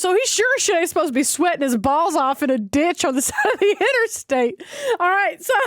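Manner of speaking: usual speaking voice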